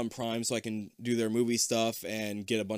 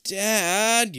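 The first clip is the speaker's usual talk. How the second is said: high, loud drawn-out plea; acted, exaggerated pleading; 9 semitones higher, 6 dB louder than usual. A narrator's reading. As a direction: whine it like a begging kid. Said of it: "you plead".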